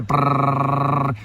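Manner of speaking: long rolled rattle like gunfire; machine-gun trill. Shown as a demonstration. Showing tightly packed map lines, machine-gun noise. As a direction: imitate rapid staccato machine-gun fire. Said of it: "rapid staccato, like machine gun fire".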